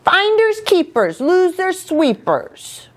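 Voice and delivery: high playground taunt; teasing story-character chant. A narrator's reading. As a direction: gloat in a high childish voice. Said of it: "Taunting. That's not very nice".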